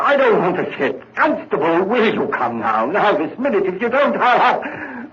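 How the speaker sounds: high, loud, with a nervous laugh; a murderer cracking with panicked laughter; hysterical panic. Acted. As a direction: unravel into hysteria. Guilt-driven hysteria at constable.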